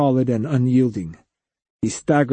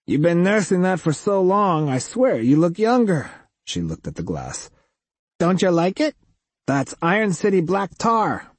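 higher, flat, nasal waitress voice; weary waitress teasing a customer; 7 semitones higher than usual. A narrator's reading.

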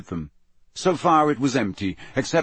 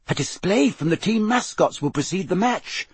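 higher, eager programme reading; reading aloud; 8 semitones higher than usual. A narrator's reading. Hermione reads the programme to friends, reading aloud. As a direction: read it out for the others. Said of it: "she read aloud".

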